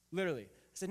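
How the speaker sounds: normal talking voice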